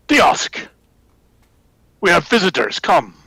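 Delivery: high shouted call to others; yelling out. A narrator's reading. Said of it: "they yell out".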